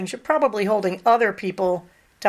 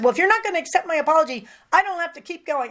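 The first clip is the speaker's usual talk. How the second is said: imitating a huffy colleague, higher and fast; mock the offended coworker, rushed and high-pitched; 7 semitones higher, faster than usual. Retelling colleague's huffy reply.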